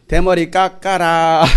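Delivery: slow, drawn-out playground chant; sing-song jeering at the bald prophet; mocking kids' taunt. Preacher voicing children taunting Elijah, slow mocking chant. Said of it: "이렇게 비웃은 거예요".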